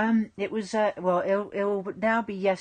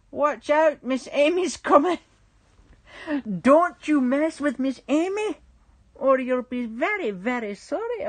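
loud, higher mock warning in Scottish; put-on Scottish accent; 4 semitones higher, 7 dB louder than usual. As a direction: threaten playfully in a big Scottish accent. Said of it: "I haven't done my Scottish accent".

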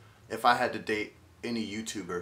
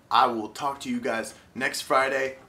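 usual speaking voice

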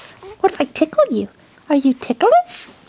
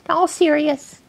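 normal talking voice

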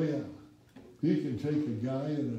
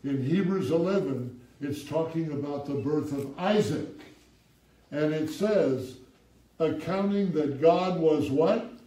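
loud, raised preaching with call and response; fervent pulpit reading; preaching at full force; 5 semitones higher, 7 dB louder than usual. Acted. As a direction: drive the verse home, slowing on the key line. Preaching scripture with call-outs.

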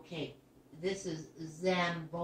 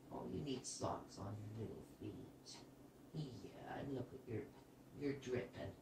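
soft, low and gentle, much quieter; tender; 9 semitones lower, 14 dB quieter than usual. Real, not acted. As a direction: coo softly over a little one. Gently fussing over wet toddler.

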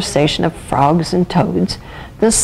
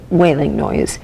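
ordinary delivery

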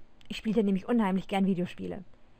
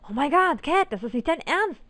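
yelled disbelief; 5 semitones higher, 6 dB louder than usual. A narrator's reading. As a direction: cry out in shocked disbelief down the phone. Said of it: "brüllte Melanie ins Telefon".

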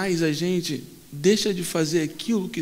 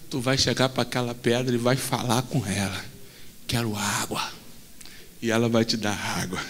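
low, soft reassuring God; deep calm voice of God; 8 semitones lower, 5 dB quieter than usual. Acted. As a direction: settle him down gently from above. God calmly reassuring Moses.

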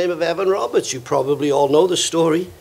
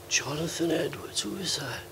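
much softer, baffled question; hushed, mock-clueless reply; puzzled student; 13 dB quieter than usual. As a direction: imitate a student quietly drawing a blank. Acted student who never heard of him.

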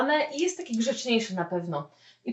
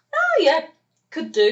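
high, louder offhand yes; casual offhand yes, pitched way up; casual acceptance; 6 semitones higher, 4 dB louder than usual. Acted. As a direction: toss off an easy yes. Casual pub invitation reply.

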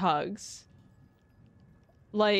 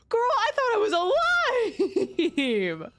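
surprised; 12 semitones higher, 3 dB louder, faster than usual. Real, not acted. Surprised at dying.

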